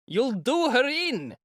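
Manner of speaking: high, drawn-out protesting shout; warning shout. A narrator's reading. A bystander warns Mikolka, drawn-out shout. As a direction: call out in alarm from the crowd. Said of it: "shouted a third".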